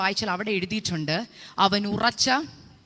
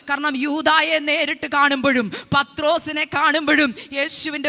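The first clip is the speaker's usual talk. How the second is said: a somewhat raised, earnest sermon build with a hallelujah; fervent preaching; 4 semitones higher, 6 dB louder than usual. Acted. Preacher telling the church about Jesus weeping in prayer, rising fervent preaching. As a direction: climb back into the preaching voice, not yet at full force.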